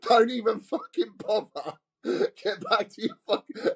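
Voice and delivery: pitched high, a mock furious telling-off; angry sergeant. A sergeant sends a late lad off the range, angrily. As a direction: play the sergeant sending him away in disgust. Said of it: "Gary's like".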